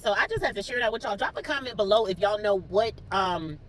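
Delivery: ordinary talk, nothing marked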